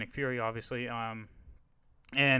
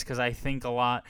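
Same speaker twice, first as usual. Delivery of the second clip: ordinary talk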